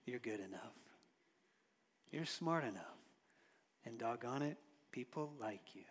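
Stuart Smalley affirmation. Retold or imitated. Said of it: "a character named Stuart Smalley".

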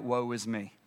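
very low, hushed dread; subdued lament. Acted. Isaiah on the floor before God, subdued lament. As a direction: let it sink low and soft. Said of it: "he's crying out".

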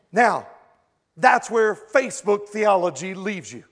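ordinary delivery

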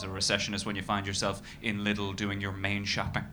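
usual speaking voice

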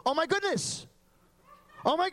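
high, loud amazed exclamation; retelling a stunned outburst; astonished gasp. Retold or imitated. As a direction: gasp it out like you can't believe it. Amazed at matching dream.

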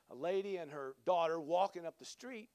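ordinary talk

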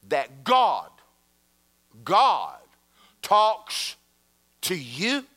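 acted-out disbelief, loud and word by word; incredulous outrage. Offended woman questioning a youth pastor, mock incredulous. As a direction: punch each word with a pause between, scandalized.

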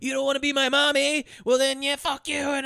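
high-pitched mock child tantrum; petulant child. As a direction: act out a spurned little boy losing it. Retold tantrum of movie's child.

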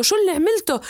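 father shouting harder at Omar; angrier yell. Acted. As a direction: push the rage up a notch. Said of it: "صار يصيح عليه".

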